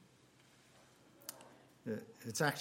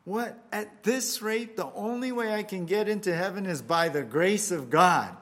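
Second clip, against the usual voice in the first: much higher, louder, exasperated outburst; frustrated shout; 5 semitones higher, 7 dB louder than usual. Acted. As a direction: yell it as a man at his wits' end. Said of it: "he shouts and he says".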